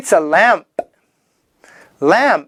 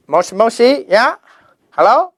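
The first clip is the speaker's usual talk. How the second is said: mock phone answer; 4 semitones higher, faster than usual. Acting answering a telephone.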